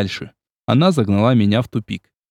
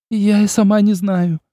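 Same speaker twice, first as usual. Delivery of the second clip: sobbing; 9 semitones higher than usual. A narrator's reading. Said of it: "всхлипнула Ольга".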